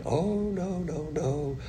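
soft, lightly mocking refusal; mock disavowal. Preacher mimicking seminary students changing their minds, mock disavowal. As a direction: imitate a prim denial, softly.

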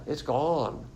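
usual speaking voice

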